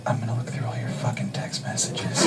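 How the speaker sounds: close, menacing whisper; sexy whisper. Retold or imitated. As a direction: lean in and whisper the threat. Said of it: "she leans in really close and she goes".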